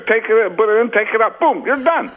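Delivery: high-pitched, blustering sportscaster impression; John Madden. Comic mocking his own impressions in the bedroom, as John Madden. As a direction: blurt it high in broken bursts.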